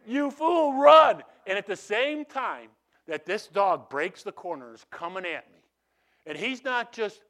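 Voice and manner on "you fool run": high, loud cry calling himself a fool; panicked yell. Retold or imitated. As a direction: shout at yourself to flee. Inner voice yells at himself.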